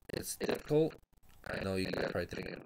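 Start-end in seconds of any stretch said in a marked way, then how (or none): 0.1-0.9 holding back tears. Real, not acted. Quitting his channel, telling viewers, fighting back tears. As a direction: voice cracking high on a tearful breath. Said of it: "I'm trying not to cry again".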